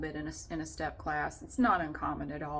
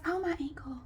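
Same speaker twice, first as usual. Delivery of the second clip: whiny attention seeker; 10 semitones higher than usual. Retold or imitated. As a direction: ham up the injured drama queen, fishing for sympathy. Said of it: "Through the entire freaking class".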